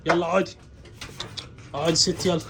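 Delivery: usual speaking voice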